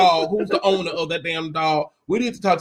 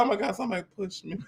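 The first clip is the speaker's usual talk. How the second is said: retold fast and soft; 12 dB quieter, much faster than usual. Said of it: "She's like".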